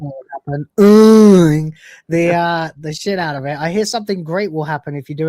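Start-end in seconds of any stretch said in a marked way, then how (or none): 0.8-1.7 loud, long high hum; emphatic mm. Pretend. Host urging viewers to hit the button, emphatic hum. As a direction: push out a big drawn-out "mm". The noise reused as a verb.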